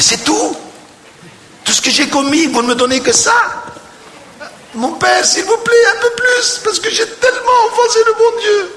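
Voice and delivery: hammed-up wail, very high-pitched; tearful pleading. Weeping penitent begs confessor for a harsher penance, hammed-up tearful wail. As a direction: plead in an exaggerated, high crying voice. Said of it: "plus l'autre pleurait".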